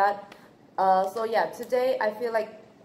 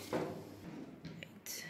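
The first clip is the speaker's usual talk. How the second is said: whispered to herself; breathy little aside; 15 dB quieter than usual. Real, not acted. Quiet aside.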